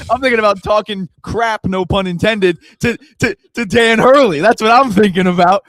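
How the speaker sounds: loud, high hyped-up brag; excited boast. Real, not acted. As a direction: pump it up, bragging loudly. Hyped boast about trash talk.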